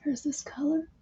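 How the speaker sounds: soft whisper to self. Real, not acted. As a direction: whisper it to yourself. Whispered aside.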